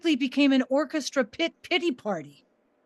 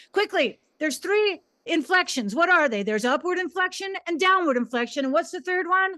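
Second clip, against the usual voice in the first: higher, brisk teacher quiz; quizzing the class; 6 semitones higher than usual. Retold or imitated. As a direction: fire questions at the students.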